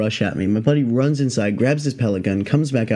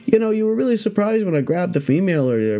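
lightly voiced handler, pitched up; unbothered snake handler; 7 semitones higher than usual. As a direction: imitate a pro explaining, higher voice. Handler quoted explaining.

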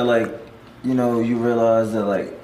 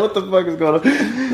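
laughing disbelief; 9 semitones higher than usual. Retold or imitated. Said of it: "I was like".

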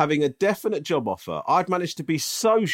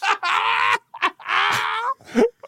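helpless high squealing laugh; high-pitched laughter. Real, not acted. Listener reacting to a friend's embarrassing story, high helpless laughter. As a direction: burst out in high, shrieking laughter. Punchline lands, loud burst of laughter.

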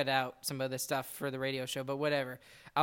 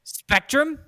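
loud, higher mock-impressed listing; sarcastic; 3 semitones higher, 9 dB louder than usual. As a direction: announce each name like a grand prize. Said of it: "I'm being sarcastic".

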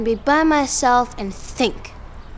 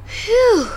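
relieved phew; 9 semitones higher, 4 dB louder than usual. Acted. Relief after hiding.

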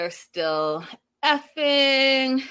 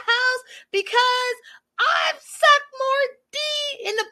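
much higher and louder mock rant; shrieking it out the way she yelled it; Jasmine's outburst; 9 semitones higher, 4 dB louder than usual. Retelling Jasmine screaming at Gino, as a hammed-up high rant. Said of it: "I'll say it like this".

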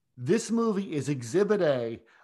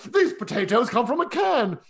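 loud, high, drawn-out mock outburst; John Cleese angry; 7 semitones higher, 6 dB louder than usual. Host imitating the policeman as a Cleese character, very angry. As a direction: explode slowly in hammed-up comic fury. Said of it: "like, getting very angry".

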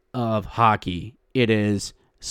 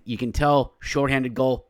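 normal talking voice

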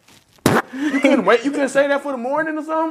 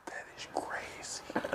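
hushed unvoiced whisper; 18 dB quieter, much slower than usual. Real, not acted. Stunned friends whispering over a wild story. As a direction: breathe it out in disbelief.

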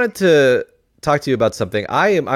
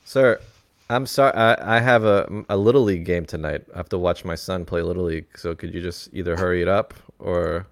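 lower, weary worker voice; lightly played impatient employee; tired clerk; 6 semitones lower, 3 dB quieter than usual. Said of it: "I'm gonna be the person who works at the place".

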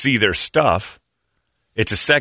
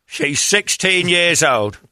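high, drawn-out British actor impression; Michael Caine; 8 semitones higher, much slower than usual. Host imitating a favorite actor, slow high British impression. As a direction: stretch it out in a high-pitched English voice. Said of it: "Michael Caine tries not to have one".

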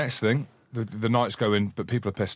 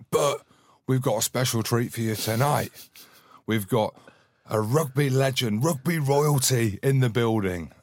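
louder, higher hype announcement; mock MC building up a guest of honour; event announcer; 5 semitones higher, 4 dB louder than usual. Acting the organiser introducing him.

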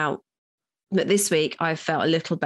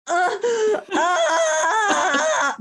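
high-pitched sobbing moan, hammed up; mock cry orgasming. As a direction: wail in exaggerated ecstatic tears. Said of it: "kinda like cry orgasming".

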